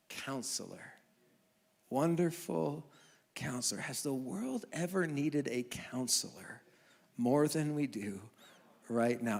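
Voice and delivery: low, soft, solemn reading; solemn preaching. Acted. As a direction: let the names sink in reverently. Preaching over music.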